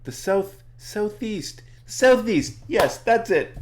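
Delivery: high-pitched, building excitement of remembering; really excited. Acted. Elmo suddenly remembers where the door is, excited. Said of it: "he starts getting really excited".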